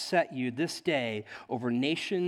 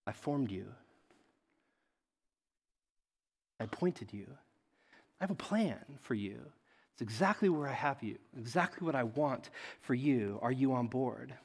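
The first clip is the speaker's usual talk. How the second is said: God's gentle voice; 4 semitones lower, 5 dB quieter than usual. Retold or imitated. God softly reassuring the preacher, low and quiet. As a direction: speak softly as God calming him. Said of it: "He's like".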